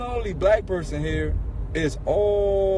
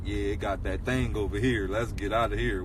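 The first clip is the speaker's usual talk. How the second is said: low wary warning; 10 semitones lower, 5 dB quieter than usual. Retold or imitated. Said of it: "we could be like".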